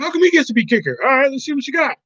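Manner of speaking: high, pushy whining voice; hammed-up whiny movie quote. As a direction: imitate the guy begging. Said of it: "He's like".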